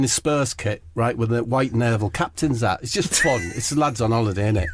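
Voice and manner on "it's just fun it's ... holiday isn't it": normal talking voice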